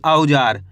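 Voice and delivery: औजार dropped low and settled; low, firm answer. Shown as a demonstration. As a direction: give the answer as the final word. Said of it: "कैसे पढ़ेंगे इसको अपन?". (औजार को नीचे स्वर में पक्का बोलें)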